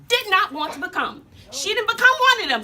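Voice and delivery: fervent outburst; rising fervor. Acted. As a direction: build to a passionate peak. Climax of life story.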